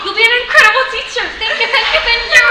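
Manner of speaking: high, loud, excited gush; overjoyed. Acted. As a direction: gush with relief and delight. Overjoyed mother and daughter.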